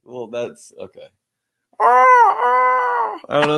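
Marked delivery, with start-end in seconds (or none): 1.8-3.6 long high screeching shriek; pterodactyl. Pretend. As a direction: screech like a giant bird. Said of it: "You do a pterodactyl".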